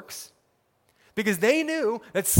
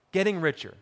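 normal talking voice

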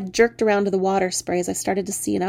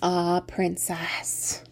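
soft fairy voice; 3 semitones lower, 8 dB quieter, much slower than usual. Acted.

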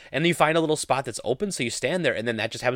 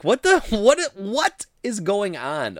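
high, stunned "what the", then a slow "what is going on"; retold bewildered reaction to being heckled; disbelief; 9 semitones higher, 5 dB louder, much slower than usual. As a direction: react like you can't believe the heckling. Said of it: "And I'm like".

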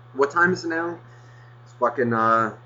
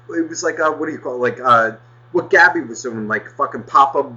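normal talking voice

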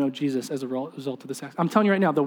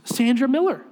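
high, louder, emphatic reveal of a name; excited reveal; 9 semitones higher, 5 dB louder than usual. Retold or imitated. Mom revealing to dad whom she met, excited. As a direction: announce it as startling news.

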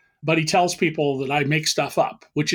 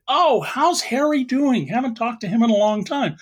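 acted fond interest; 6 semitones higher than usual. Cops on the phone about the hitman, acted fond interest. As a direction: pitch it up, as if asking after an old pal.

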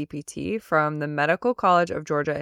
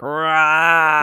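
hangry growl; 5 dB louder than usual. Retold or imitated.

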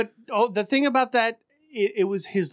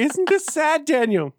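higher, pleading mother voice; mock sad mother; 7 semitones higher than usual. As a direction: imitate mom asking if it's sad. Mother voiced in his story.

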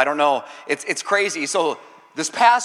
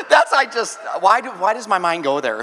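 ordinary talk

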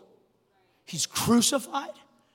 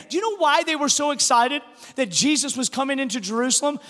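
ordinary delivery

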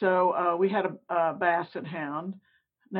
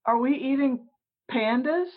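lightly voiced child, pitched higher; nine-year-old; 5 semitones higher than usual. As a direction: innocent kid's question, with pauses. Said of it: "In only the way that, like, a nine-year-old would say".

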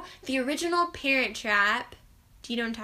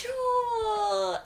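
gasping shriek of the name; shocked gasp; 10 semitones higher, 7 dB louder than usual. Real, not acted. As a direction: gasp then cry out in shock. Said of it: "I love this story".

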